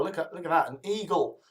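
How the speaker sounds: ordinary talk, nothing marked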